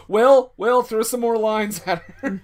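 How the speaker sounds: loud laughing call-out; teasing through laughter; laughing tease. Real, not acted. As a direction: laugh as you call to him. Laughing joke at [name].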